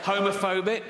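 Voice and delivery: usual speaking voice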